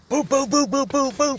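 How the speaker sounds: rapid high, loud "boop" punches; imitated punch sounds. Shown as a demonstration. Teller acting out the shower beating, punchy. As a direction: fire off a volley of high-pitched blows.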